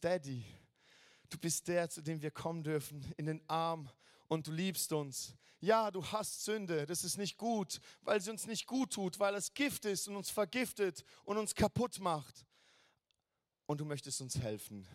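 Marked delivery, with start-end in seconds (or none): none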